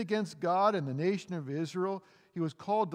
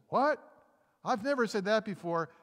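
high, louder startled reply; mock surprise; 5 semitones higher, 3 dB louder than usual. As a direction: play the baffled groom, pitch jumping up. Acts out startled groom's reply.

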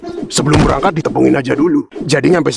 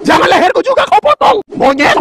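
high, alarmed yelling; frantic high-pitched scolding; panicked protest; 4 dB louder than usual. Acted. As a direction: shout it in fright as a blade nears your neck. Panics as neck nearly gets cut.

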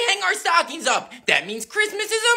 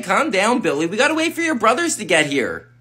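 usual speaking voice

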